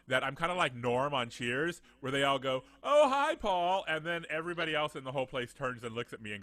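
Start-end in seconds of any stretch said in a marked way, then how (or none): 2.8-3.8 high, loud cheery call; imitated chorus of hello; mock group greeting. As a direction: call out like a whole bar greeting a regular. Regulars greet him in unison.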